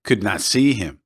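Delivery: plain reading voice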